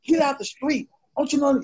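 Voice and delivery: retold cop ordering him off the road, high and bossy; mock officer scolding. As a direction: bark the order like an impatient officer. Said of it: "He's like".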